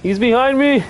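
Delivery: high, panicked cry; panicking gamer. Real, not acted. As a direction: yelp as he's caught. Monster appears behind him.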